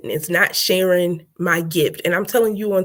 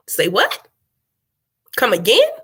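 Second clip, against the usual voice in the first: short questions swooping up at the end; mock disbelief. Speaker challenging listeners who wait to be invited, mock disbelief. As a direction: play at not believing your ears.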